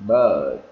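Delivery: "bird" loud, long, dropping in pitch; long "ơ" vowel. Shown as a demonstration. Teacher repeating the model word, "bird" stretched. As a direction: hold "ơ" in "bird" and let it fall. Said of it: "nếu kéo dài ra".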